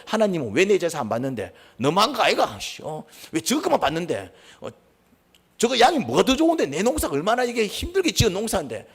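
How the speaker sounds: high, loud, indignant protest to God; seething complaint. Acted. Cain protests his rejected offering to God, seething. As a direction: act out a fuming grievance, pitch climbing as it builds. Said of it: "막 이러면서 막 씩씩거리고 있다".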